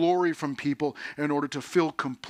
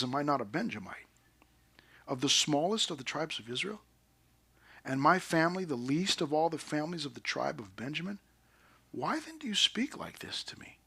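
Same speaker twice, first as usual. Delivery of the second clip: hushed, humble reply; 7 dB quieter than usual. Retold or imitated. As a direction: answer quietly, almost under the breath. Saul's timid answer, read out.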